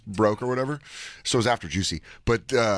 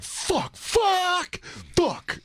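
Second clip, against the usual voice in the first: high, rising panicked cursing; boy realising his mistake; panicked inner curse; much slower than usual. Retold or imitated. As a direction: curse like a caught-out kid. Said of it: "I was like".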